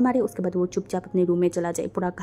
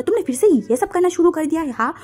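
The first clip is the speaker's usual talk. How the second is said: loud scolding that starts high; furious raised-voice accusation; angry shout; 5 semitones higher, 7 dB louder than usual. A narrator's reading. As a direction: lash out loudly, high then dropping. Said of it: "गुस्से में देखा and चिल्ला के बोला".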